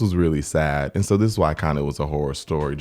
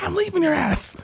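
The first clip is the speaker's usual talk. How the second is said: very high mock defiance; retold defiant comeback. Wife answering husband on the phone, retold defiantly. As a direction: imitate her high-pitched, fed-up retort.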